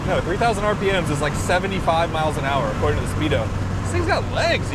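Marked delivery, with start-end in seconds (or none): 3.8-4.7 excited. Real, not acted. Thrilled repeat of the line.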